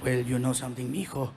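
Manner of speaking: low, heavy voice of a Mexican father; deep fatherly voice, slowing at the end; imitating his dad. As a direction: drop well down into a dad talking to his son. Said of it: "He goes".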